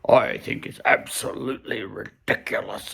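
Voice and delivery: mock the quoted politician, hammed up. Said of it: "he's got this mocking tone".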